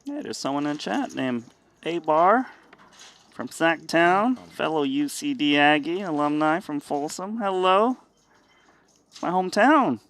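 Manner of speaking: light up greeting a fellow alum; excited. Real, not acted. Greeting a hometown fan in chat.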